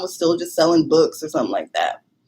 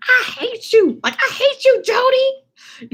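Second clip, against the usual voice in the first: much higher whiny mock accusation with a laugh in it; mock angry whine; slower than usual. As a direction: playfully blame your friend in a squeaky voice. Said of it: "I was like that".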